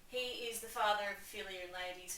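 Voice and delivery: ordinary talk, nothing marked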